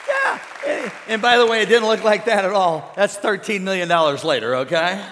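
loud preacher rallying the crowd; full-force preaching. Real, not acted. Preacher urges church to give God praise, full force. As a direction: call the congregation to praise, loudly.

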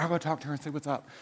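quick, hushed imitation of a girl at prom; mock girlfriend. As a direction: rattle it off softly like a helpful friend. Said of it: "the girls will be like".

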